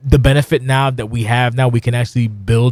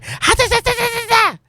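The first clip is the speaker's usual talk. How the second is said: very high, rapid mock yapping; squeaky cartoon chatter mocking a hyper wrestler; hyper rabbit. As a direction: bounce it out like a wired rabbit. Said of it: "instead of being a fucking hyper rabbit".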